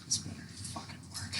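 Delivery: whispered hope before the roll; whispering. Real, not acted. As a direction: breathe it under your breath.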